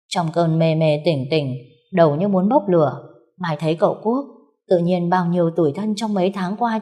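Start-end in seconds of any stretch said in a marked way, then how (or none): none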